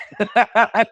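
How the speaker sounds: short high burst of laughter; quick, high-pitched chuckle; amused laugh. Real, not acted. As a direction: laugh at your own realization. Amused at his younger self.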